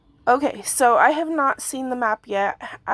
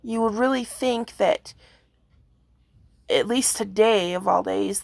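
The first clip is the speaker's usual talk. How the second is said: ordinary talk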